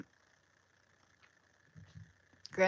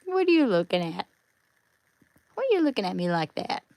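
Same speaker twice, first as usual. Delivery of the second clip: playful quick question; 8 dB louder, much faster than usual. Real, not acted. Asking the dog about its look, fast and playful. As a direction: tease the dog for staring.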